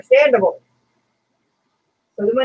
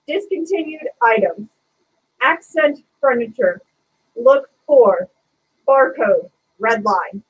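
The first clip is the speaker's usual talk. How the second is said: put-on phrase by phrase, pausing after "Discontinued items", "accent furniture", "look for"; pointed word-by-word reading. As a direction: set down "barcode" and "red line" as separate, deliberate items, like a smart-ass. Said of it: "I pointed at the words on the sign as I said them".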